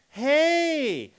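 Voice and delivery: hey sliding up high; bright upward hey; rising hey. Shown as a demonstration. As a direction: greet a long-lost friend. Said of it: "that tonality that goes up".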